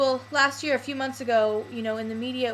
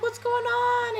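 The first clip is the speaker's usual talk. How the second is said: much higher mock instructor asking; imitating concerned instructor; 12 semitones higher, slower than usual. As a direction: play the worried instructor. Instructor sees her crying.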